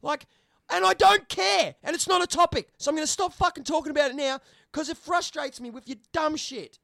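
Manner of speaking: high, loud frustrated rant; angry rant. Real, not acted. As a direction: tear into the commenter, voice climbing. Ranting angrily at commenter.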